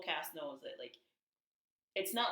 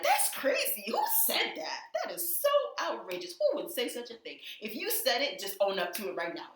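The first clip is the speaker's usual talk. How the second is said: high, loud fake outrage; hammed-up Portia, much higher and louder; mock outraged Portia; 7 semitones higher, 5 dB louder than usual. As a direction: squeal the shock like a guilty gossip. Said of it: "Portia is in the corner like".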